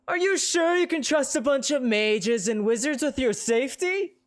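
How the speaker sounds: loud, high preacher voice; a zealot rousing the street; loud preaching. Acted. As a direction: preach it to the crowd at full volume. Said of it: "in a loud voice".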